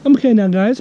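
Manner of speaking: ordinary talk, nothing marked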